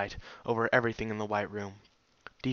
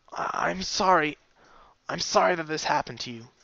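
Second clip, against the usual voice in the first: higher, breaking with grief; voice cracking; 5 semitones higher, 3 dB louder than usual. A narrator's reading. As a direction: apologize as the voice breaks. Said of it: "punctuated with an emotional voice crack".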